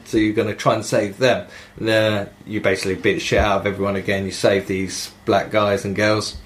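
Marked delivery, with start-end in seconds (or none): none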